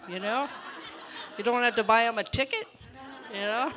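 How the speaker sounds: laughing it out loud and fast; bubbling over with laughter mid-joke; loud, giggly delivery of a punchline. Real, not acted. Laughing joke about the Lord's ticket.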